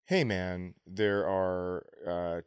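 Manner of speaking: usual speaking voice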